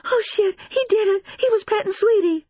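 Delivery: high, loud, horrified realization; hysterical, tearful woman; breathless distress. A narrator's reading. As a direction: wail it out in shock. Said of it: "She can hardly catch her breath".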